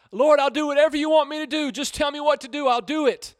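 high, loud, rushed promise; desperate pleading. Acted. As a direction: beg to be told what to do. Jack desperately agrees while hanging.

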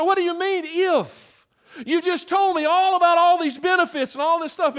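high-pitched, incredulous challenge; retold indignant questioning; baffled protest. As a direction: argue back in disbelief. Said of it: "I'm going in my mind".

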